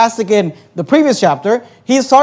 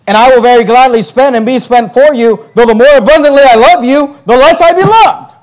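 sarcastic martyr, rising higher and louder; 3 semitones higher, 5 dB louder than usual. Retold or imitated. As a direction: build the mock complaint to a loud, high finish. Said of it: "he's obviously being sarcastic".

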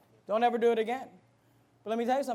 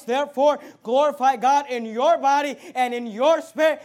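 loud, driving pulpit declamation; full-voiced preacher reading aloud; forceful scripture reading; 8 dB louder than usual. Real, not acted. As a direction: proclaim the verse with hammered stresses.

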